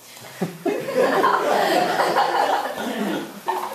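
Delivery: laughs out loud at her own joke; a breathy burst of laughter; real laugh. Real, not acted. Reader laughs at own line.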